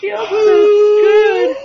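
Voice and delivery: very high mock moan, building loud; hammed-up squeal rising to a loud peak; imitating Rick. Host mocking Rick's night, loud high moaning. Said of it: "That's what Rick was doing last night".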